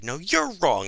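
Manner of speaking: playfully offended correction; mock indignant. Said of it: "I'm all indignant".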